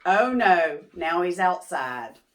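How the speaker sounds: usual speaking voice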